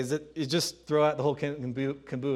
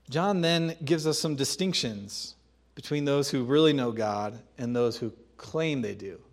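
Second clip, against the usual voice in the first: normal talking voice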